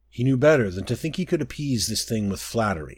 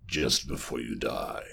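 much lower and softer, a dying hush; deep, soft monstrous threat; ominous creature murmur; 11 semitones lower, 7 dB quieter than usual. Acted. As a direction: let the threat sink into the ear.

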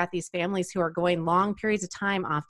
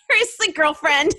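louder, higher mock exasperation at Mary; exasperated; 8 semitones higher, 7 dB louder than usual. Reader annoyed at Mary, exasperated "seriously girlfriend". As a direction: roll your eyes at her. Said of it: "I'm like".

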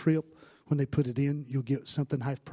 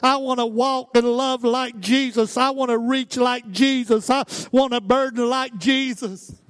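loud, high-pitched revival preaching; impassioned preaching; 10 semitones higher, 11 dB louder, slower than usual. Real, not acted. Preacher declaring he wants to be like Jesus, impassioned preaching. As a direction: preach it hard, each wish driven home. Preacher at full force in sermon.

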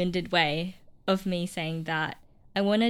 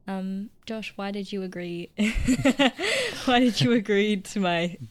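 stifled giggles; 3 dB louder than usual. Real, not acted. Laughing at her own question.

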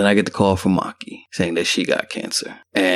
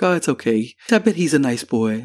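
lightly imitated lady, pitched up; higher voice, mimicking a reassuring mother; lady reassuring a child; 5 semitones higher than usual. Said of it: "And the lady's like".

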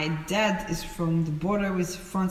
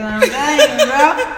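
laughing; 7 semitones higher, 11 dB louder than usual. Real, not acted. Laughing about her mom.

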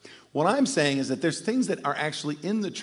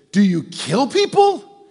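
much higher, louder, slow and alarmed; shocked wife; 10 semitones higher, 9 dB louder, slower than usual. Retold or imitated. Wife asking her spy husband if he kills, horrified. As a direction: squeal it out in disbelief.

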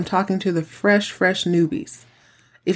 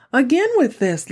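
exasperated; 7 semitones higher than usual. Real, not acted. Exasperated at recycled plot.

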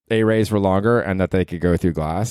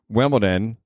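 usual speaking voice

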